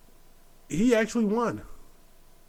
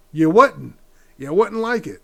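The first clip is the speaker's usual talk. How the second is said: loud retort; 3 semitones higher, 10 dB louder than usual. Retold or imitated. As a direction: push back hard on the toucher. Retold confronting a toucher.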